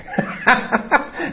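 short breathy laugh; amused chuckle. Real, not acted. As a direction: chuckle at your own example. Laughs at his own example.

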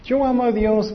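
high-pitched mock pious claim; self-declared believer. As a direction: imitate people boasting, pitched well up. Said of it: "Muchas personas dicen".

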